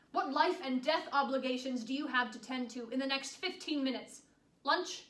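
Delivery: loud, indignant telling-off; indignant protest. Acted. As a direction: scold the guard, hitting key words hard. Visitor indignantly argues with prison guard.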